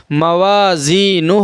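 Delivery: slow "موازینہ", long aa, ee and final oo; drawn-out recitation. Shown as a demonstration. Quran teacher modelling the word, "موازینہ" slowly stretched. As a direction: hold each long vowel in "موازینہ". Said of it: "ایک الف کے برابر کھینچ کر پڑھیں گے".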